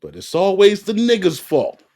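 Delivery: high, loud exasperated exclaim; sarcastic outburst. Real, not acted. Creator reacting to the therapist's firing, loud sarcastic outburst. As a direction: throw it out in disbelief.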